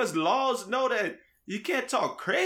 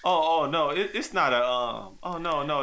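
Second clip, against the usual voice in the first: ordinary talk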